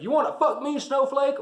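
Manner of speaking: high, loud mock drill-sergeant bark; drill sergeant yell. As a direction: scream it like a movie drill instructor. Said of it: "when he yells".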